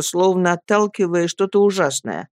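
ordinary delivery

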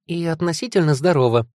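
high, tearful-cheerful quip; attempted joke. A narrator's reading. Tearful daughter joking to her father, brave quip. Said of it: "шмыгнула, пытаясь шутить".

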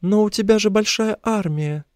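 very high female character voice; high-pitched hopeful captive; captive woman. A narrator's reading. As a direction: protest in a high, plaintive woman's voice. Woman captive questions her rescuer.

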